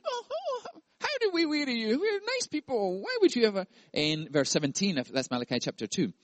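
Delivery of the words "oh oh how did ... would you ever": high, breathy mock-innocent protest; offended innocents. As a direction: play the wounded innocent, much higher. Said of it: "And the people said".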